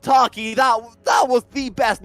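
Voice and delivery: shouting with hype, slowing down; excited shout. Real, not acted. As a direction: yell it like a caster calling a huge play. Caster erupts at huge hook.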